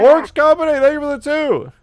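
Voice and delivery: very high, loud shout-out; shouted thanks. Real, not acted. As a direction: holler thanks to a donor.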